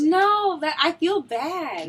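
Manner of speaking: high, loud and slowed no; loud protest. Real, not acted. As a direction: blurt it out, then drag.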